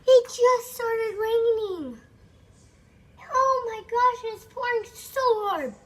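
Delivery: loud, high, drawn-out words; real alarm, calling out the storm news; alarmed outcry. Real, not acted. Child alarmed by sudden storm.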